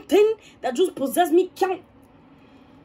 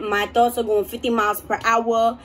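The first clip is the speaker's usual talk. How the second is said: ordinary talk, nothing marked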